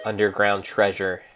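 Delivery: ordinary delivery, nothing marked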